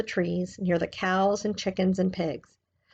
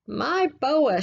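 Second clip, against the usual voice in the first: a hint of the old woman's higher voice; proud little old lady, lightly done; lightly voiced old lady; 6 semitones higher than usual. Missus Partridge voiced.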